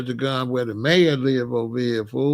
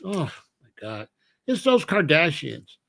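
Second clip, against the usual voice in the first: usual speaking voice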